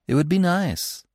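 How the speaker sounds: high-pitched, mildly bold remark; a touch of boldness, pitched high; said boldly. A narrator's reading. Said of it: "said Feline boldly".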